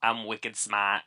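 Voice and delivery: slow, stretched Boston accent; mock Bostonian catchphrase with a Boston twang. Shown as a demonstration. Co-host joining in the demo, slow Boston accent. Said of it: "Boston is supposed to be easy".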